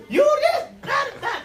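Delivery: high, loud bickering parents; hammed-up quarrel between parents; mock parents quarreling. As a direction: squawk it like fussing parents. Parents fussing at each other.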